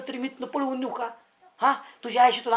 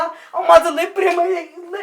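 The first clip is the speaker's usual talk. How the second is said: slow, loud, higher-pitched plea; pleading declaration; 4 semitones higher, 4 dB louder, slower than usual. Acted. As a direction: profess love at full voice, drawn out. Suitor declares love to the aunt. (कळकळीने, मोठ्याने प्रेमाची कबुली)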